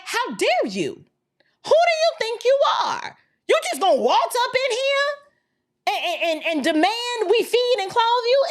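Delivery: how dare you, rising in indignation; indignant outrage. Retold or imitated. Legal immigrants' outraged retort.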